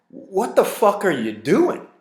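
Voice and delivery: a mock outraged cry at the back seat; shocked outburst. Reacting to girl puking in car.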